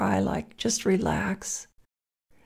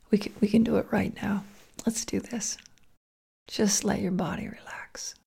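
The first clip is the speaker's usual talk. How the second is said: guided meditation. Acted.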